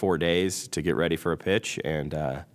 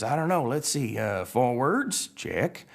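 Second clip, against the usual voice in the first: higher, tentative tow truck testing himself; Mater; 9 semitones higher, much slower than usual. Acted. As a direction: try each move aloud, rising on the last. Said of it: "Mater says".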